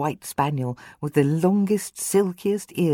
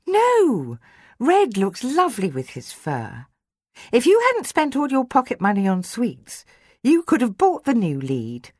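put-on girl's voice; 9 semitones higher than usual. Georgia, a girl, replying to Adam.

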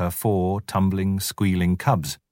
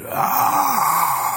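high, full-throated roar; tiger roar; 10 semitones higher than usual. Acted. Narrator roaring as Shere Khan charges. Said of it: "full-throated Arr of the tiger's charge".

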